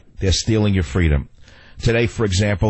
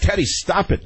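retold scolding; 8 semitones higher than usual. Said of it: "I actually lost my temper".

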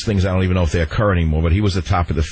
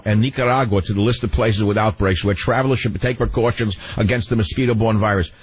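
ordinary delivery

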